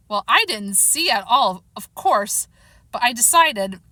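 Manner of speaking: ordinary delivery, nothing marked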